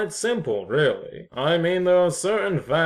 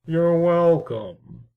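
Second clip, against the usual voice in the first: smug drawl; 4 dB louder, slower than usual. Acted. Smug reply to sarcasm.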